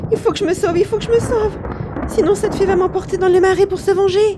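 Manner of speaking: put-on high, loud, rushing boy; panicked boy. Jacques panicking before the angry fairy, fast and loud. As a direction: flee in a panic. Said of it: "Jack est complètement affolé".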